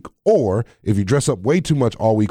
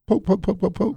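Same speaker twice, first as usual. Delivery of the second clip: quick, staccato jabs, higher and softer; rapid-fire mimed prodding, small and high; playful poking; 7 semitones higher, 4 dB quieter than usual. Pretend. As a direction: jab each poke out lightly, in little bursts. Acts out Satan poking again.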